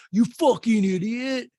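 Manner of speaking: high, loud, drawn-out insult; mock angry yell. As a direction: yell it slowly in mock outrage. Acts out egg toss insult.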